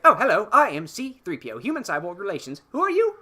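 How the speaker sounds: mock chirpy robot, squeaking up on "Who are you"; C-3PO. Podcaster voicing a memory-wiped C-3PO greeting the crew, lightly prim and chirpy. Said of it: "wakes back up and is like".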